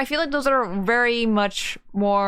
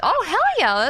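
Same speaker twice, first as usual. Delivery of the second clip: excited cheer; 9 semitones higher, 3 dB louder than usual. Real, not acted. Excited at tank level.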